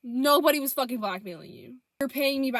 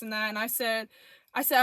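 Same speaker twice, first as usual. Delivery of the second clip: ordinary talk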